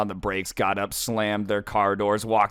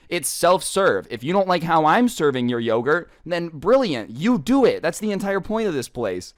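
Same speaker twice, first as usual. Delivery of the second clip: higher-pitched, exasperated imitation; annoyed; 5 semitones higher than usual. Worker annoyed at picky customers, imitated exasperation. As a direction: voice the worker's mock-exasperated retort. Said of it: "getting annoyed because it's like".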